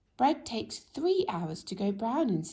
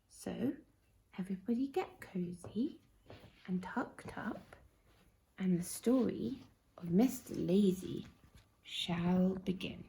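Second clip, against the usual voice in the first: hushed bedtime read. Acted. Narrator settling children for bed, hushed. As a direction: hush them down for the story.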